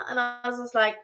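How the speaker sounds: ordinary talk, nothing marked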